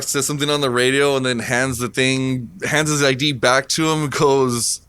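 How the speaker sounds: normal talking voice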